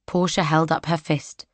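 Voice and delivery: ordinary delivery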